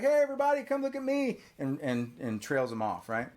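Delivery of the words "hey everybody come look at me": high, raised call to a crowd; a kid's lively call for attention. Retold or imitated. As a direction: lure the zombies toward you. Sean calls zombies to him.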